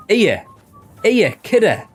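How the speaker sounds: high, loud Mackem greeting; Sunderland accent. Shown as a demonstration. Player demonstrating how locals greet a kidder, Sunderland accent. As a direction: hail a mate in exaggerated Sunderland accent. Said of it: "It's like Sunderland accent".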